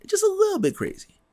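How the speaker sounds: lightly voiced lunatic; mock crazy squeak. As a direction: let it crack into a tiny bit of madness. Calling Batman crazy.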